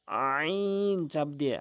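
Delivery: আইন slowed and squeezed from the throat; pressed throat letter. Shown as a demonstration. Teacher demonstrating the throat আইন.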